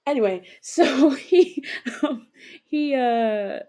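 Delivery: laughing. Real, not acted. Laughing mid-story.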